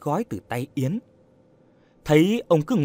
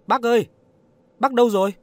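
high, raised call; calling out loudly; 9 semitones higher, 4 dB louder, much faster than usual. A narrator's reading. Yến searching for the missing old man, calling out loudly. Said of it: "Cô lớn tiếng gọi".

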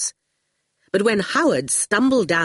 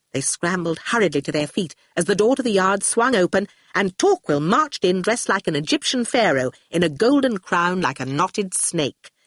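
ordinary delivery, nothing marked

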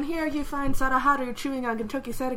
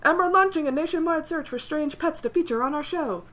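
TV announcer; 5 semitones higher, 4 dB louder than usual. Retold or imitated. TV announcer butting in.

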